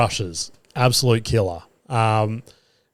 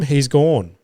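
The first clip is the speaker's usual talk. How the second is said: ordinary talk, nothing marked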